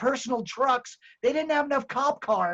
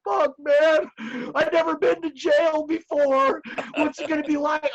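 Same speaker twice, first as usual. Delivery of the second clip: nervous friend dreading his first night in jail; scared friend; 6 semitones higher, 3 dB louder than usual. Retold or imitated. Sidekick on the way to jail, panicky and higher. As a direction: panicky voice, pitched higher and louder. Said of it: "Jake's all".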